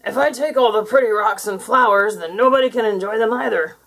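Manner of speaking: loud monster voice, realising; thoughtful creature. Acted. Creature realising something, loud monster voice.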